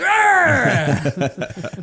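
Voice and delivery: high, loud hooting laugh; imitated happy shouting laugh. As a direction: laugh back like the delighted driver. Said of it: "And he'd be like".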